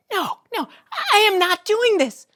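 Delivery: high, woken-up Elaine; annoyed Elaine. Acted. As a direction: scold him for waking you. Elaine refuses.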